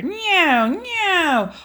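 high loud cat meow; Baby Bear playing cat. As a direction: mew like a kitten. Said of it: "went Baby Bear".

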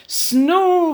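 loud high snoring sound; snore. Acted. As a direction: snore it out big. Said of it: "went Missus Bear".

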